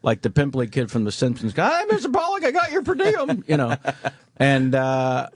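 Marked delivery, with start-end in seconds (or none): 1.6-3.4 put-on high squeaky kid voice, pitched way up; nervous PA kid. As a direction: play the pimply young assistant, voice cracking high.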